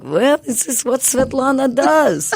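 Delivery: high-pitched dad impression; imitating dad. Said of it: "My dad's like".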